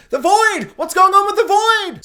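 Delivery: loud, high-pitched burst of bafflement; worked-up fan outburst; excited exclamation. Real, not acted. Mock outrage at the plot twist.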